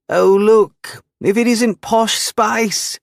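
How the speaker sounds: high, jeering teasing voice; mocking bully voice. A narrator's reading. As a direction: taunt him like a schoolyard bully.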